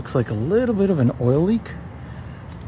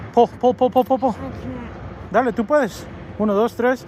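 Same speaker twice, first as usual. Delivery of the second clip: tug of war; 10 semitones higher than usual. Real, not acted. Cheering kids pulling lug wrench.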